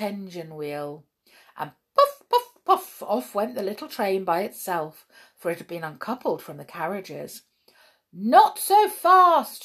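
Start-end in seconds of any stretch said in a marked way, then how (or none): 1.9-3.0 very high, punchy puffs; squeaky little engine puffing away; puffing train. A narrator's reading. Little train setting off, high puffing noises. 8.1-9.6 high, loud, drawn-out shout. A narrator's reading. Judge yelling at Hop to slow, long shout. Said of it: "shouted the judge".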